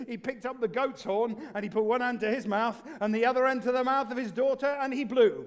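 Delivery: ordinary delivery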